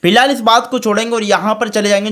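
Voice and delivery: ordinary delivery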